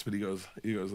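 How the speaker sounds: ordinary talk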